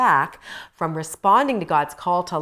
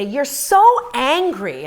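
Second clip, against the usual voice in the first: slow, higher mock husband saying you're so angry; imitating husband, drawn out and louder; husband's line; 7 semitones higher, 8 dB louder, much slower than usual. Husband to angry wife, mock slow chiding. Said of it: "and he'd say".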